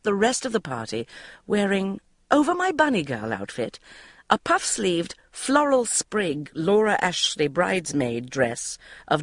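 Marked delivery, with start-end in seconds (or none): none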